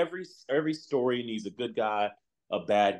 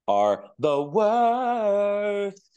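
drawn out; 9 semitones higher, 4 dB louder, much slower than usual. Acted. Stretched complaint.

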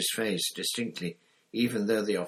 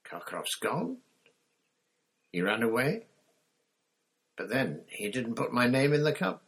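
bewildered; 6 semitones higher than usual. Acted. Harry confused.

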